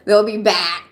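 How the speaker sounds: imitating mom. Streamer quotes her mom about viewers who left, lightly imitating her. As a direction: quote mom's confident line. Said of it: "That's what my mom always says".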